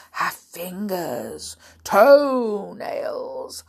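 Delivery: slow, loud, drawn-out menace; relishing beast, stretching every word; gloating crocodile. Acted. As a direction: savour each body part. Crocodile figure in story.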